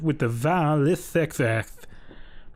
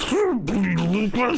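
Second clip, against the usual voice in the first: higher, a mock cry of a name; crying butthole; 6 semitones higher than usual. Dying principal's butthole crying out to Lucas, hammed-up. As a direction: call out his name in a hammed-up wail. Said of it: "heard that asshole crying".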